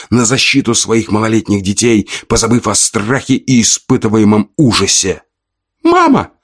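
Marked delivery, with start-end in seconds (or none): 5.8-6.3 put-on very high, loud child's cry; a small boy crying out for mom; child calling. A narrator's reading. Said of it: "послышался голос Данила".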